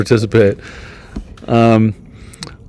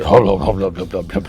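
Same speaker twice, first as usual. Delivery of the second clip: low, softer murmured hubbub; imitated background chatter, pitched down; crowd hubbub; 4 semitones lower, 4 dB quieter than usual. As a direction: mumble like a murmuring crowd, fading. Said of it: "And there's like".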